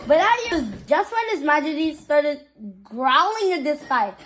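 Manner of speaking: ordinary delivery, nothing marked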